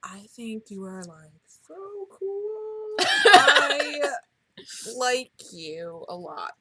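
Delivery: mock awkward. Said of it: "he was like".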